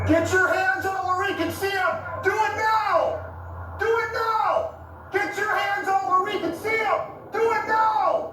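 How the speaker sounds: high, urgent yelled commands; police command shout. Real, not acted. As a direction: bark orders at a suspect. Officer commands suspect at gunpoint.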